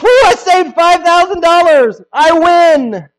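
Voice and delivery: mock triumphant buyer; gloating cheer. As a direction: gloat over the saved money. Buyer gloats over savings.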